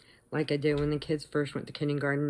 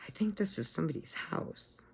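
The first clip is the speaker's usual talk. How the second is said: a softer, confidential remark; hushed aside; 8 dB quieter than usual. Retold or imitated. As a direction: quietly voice a doubt to a companion. Hushed aside on entering bakery.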